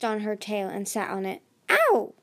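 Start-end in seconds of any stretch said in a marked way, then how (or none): 1.7-2.1 sharp, high, loud "ow"; pained yelp. A narrator's reading. Clay pounces on Glory's tail, pained yelp. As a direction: yelp as if suddenly sat on. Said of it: "Glory yelped".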